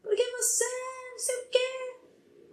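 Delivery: high mock yell of an upset boy; lightly imitated kid yelling; child yelling. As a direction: throw the boy's protest up high. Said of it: "Gritando comigo".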